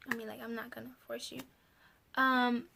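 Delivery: ordinary talk, nothing marked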